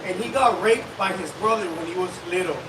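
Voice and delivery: ordinary delivery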